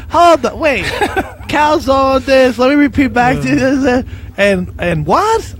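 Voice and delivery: high, slow, baffled repeating back the order; puzzled phone girl, drawn out and lost; confused receptionist. Retold or imitated. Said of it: "she used to go".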